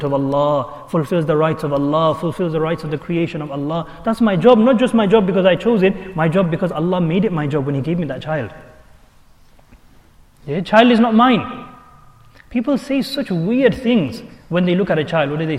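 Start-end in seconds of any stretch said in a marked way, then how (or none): none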